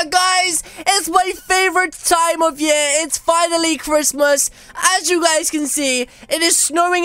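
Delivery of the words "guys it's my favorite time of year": drawn-out excited holiday yell; excited shout. Real, not acted. Youtuber greets viewers with a long excited shout. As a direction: shout it out like Christmas morning.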